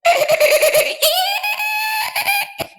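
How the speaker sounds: loud high cackling laugh; wild shrieking monster laugh; booming creature laugh. A narrator's reading. Dolphin laughs between lines.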